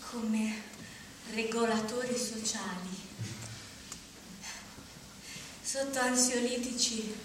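low, soft, fading tranquillised voice; drugged murmur. Acted. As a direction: let the voice drain out as if sedated.

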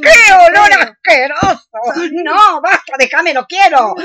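high, loud revolted protest; disgusted protest. Acted. Friend loudly refuses the smelly avocado face mask in disgust. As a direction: push the stinking mask away loudly.

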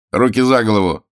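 forceful captor's shout; shouted order. A narrator's reading. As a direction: order a prisoner to surrender. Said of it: "крикнул он Пашке".

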